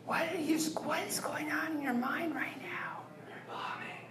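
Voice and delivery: mock inner-voice whisper. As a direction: hiss the verdict softly. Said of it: "whisper to me".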